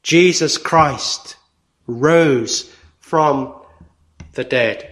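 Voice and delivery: slow solemn quote. Acted. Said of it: "God says".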